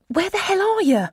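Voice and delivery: high, loud outburst; exasperated demand. A narrator's reading. Shocked friend demands an answer.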